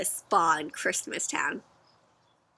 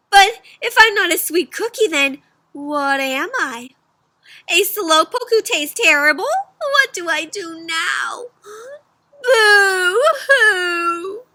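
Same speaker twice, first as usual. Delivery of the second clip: high, louder hammed-up sob; crying cookie; 8 semitones higher, 7 dB louder, slower than usual. Cookie crying about not being sweet. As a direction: wail it like a lost little cookie.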